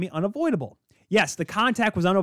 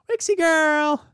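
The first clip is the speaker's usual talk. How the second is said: doggy voice; 12 semitones higher, 3 dB louder, much slower than usual. Real, not acted. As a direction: coo at the puppy up high. Greeting the dog on camera.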